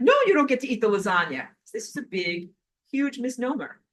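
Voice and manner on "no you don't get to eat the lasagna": fast, loud, defiant no; fast loud defiant retort; firm refusal. Retold or imitated. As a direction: slap the idea down quickly. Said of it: "I'm like".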